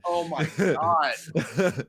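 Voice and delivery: very slow, stretched oh my God; drawn out disbelief. Real, not acted. Host groaning at the cohost's gushing remark. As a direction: stretch every word in disbelief.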